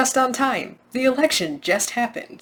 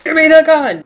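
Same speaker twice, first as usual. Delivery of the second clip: full-volume mimicked yell, high and forceful; imitated yell; 6 semitones higher, 9 dB louder than usual. Reviewer echoing the movie clip for emphasis, mock yelling. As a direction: holler it to echo the film's man. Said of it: "yelling at the top of his lungs".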